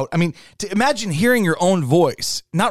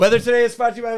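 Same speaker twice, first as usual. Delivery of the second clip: high-pitched weather patter on autopilot; autopilot weather read; 7 semitones higher, faster than usual. Shown as a demonstration. Weathercaster showing a co-host the autopilot read, high and mindless. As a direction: rattle off the forecast mindlessly, pitched up. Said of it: "like, autopilot zone".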